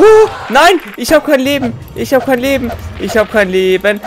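high, loud panicked wail, slowing down; panicking gamer. Real, not acted. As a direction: repeat it in rising panic. No health left, enemy attacking.

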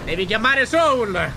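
drawn-out loud call of the name; loud slow call. Acted. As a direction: stretch it out and call loudly. Urging partner to call Soul.

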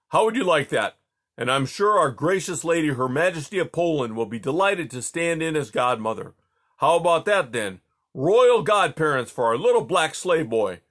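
loud mocking royal bluster; teasing jovial Tsar. Tsar mocking his slave boy, loud and teasing. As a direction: tease the boy grandly.

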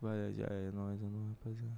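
drowsy mumbled goodbye; sleepy voice. Pretend. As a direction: sound half asleep to get out of it. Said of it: "Vou falar com voz de sono". (voz de sono, sonolento)

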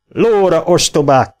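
loud, high bandit yell; angry shout to mount up; shouted order. A narrator's reading. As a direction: bark the order at them. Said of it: "kiáltotta".

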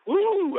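high, loud whoop of excitement; excited woo. Real, not acted. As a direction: let out a joyful woo. Fired-up motivational call.

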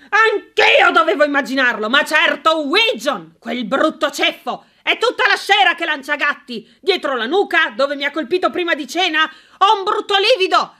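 seethe about the neighbour; indignant rant. Acted. Sir Mortimer ranting about Widgeon.

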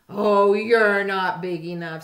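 loud, scornful brush-off; grouchy dismissive. A narrator's reading. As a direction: wave them off loudly. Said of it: "said the grouchy ladybug".

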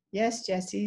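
ordinary delivery

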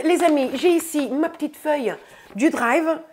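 ordinary talk

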